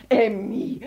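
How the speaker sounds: a touch of a mother's scolding; understated telling-off. Acted. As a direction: mildly scold the child by name. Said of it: "schimpfte die Mutter".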